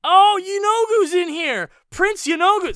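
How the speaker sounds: high, loud delighted shout; thrilled recognition of a demon prince; excited. Real, not acted. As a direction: burst out at spotting the name. Excited discovery of a name.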